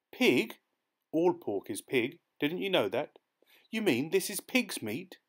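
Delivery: high, disbelieving cry; shocked. Acted. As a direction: recoil at the word pig. Shocked realisation dialogue.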